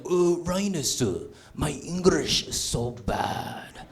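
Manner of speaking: slow, put-on broken-English Cambodian accent; a dad hamming up a fake Cambodian accent; mock Cambodian accent. Dad dodging translation duty by faking a Cambodian accent, slow and hammed. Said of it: "that's not even a good Cambodian accent".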